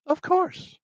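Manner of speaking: imitating her accepting the ride in a high voice; mock lady. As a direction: squeak it out like a proper lady. Woman accepts ride on bike.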